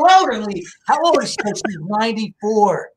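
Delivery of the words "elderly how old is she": high, incredulous questioning. Retold or imitated. Son questioning his aged mother about her neighbor, incredulous. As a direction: replay a son's disbelief, pitched up. Son reacts to mom's remark.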